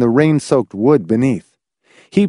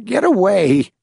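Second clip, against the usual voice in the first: buzzing warning; 10 semitones higher than usual. A narrator's reading. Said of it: "the little creature buzzed warningly".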